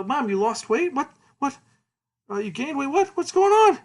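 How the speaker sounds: high-pitched flustered sputtering; confused shock. Retold or imitated. Boy baffled by his changed mom, confused shock. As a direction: build to a loud, squawked question. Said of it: "He's confused".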